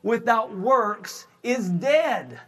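high, slow, ringing pulpit delivery with shouted peaks; full-force preaching. Acted. Pastor rousing the church to go out and witness, preaching at full force. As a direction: preach it hard and slow to the congregation.

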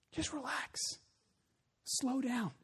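softly calm someone down; quiet retold reassurance, unhurried pauses; gentle soothing. Voices Holy Spirit soothing listener.

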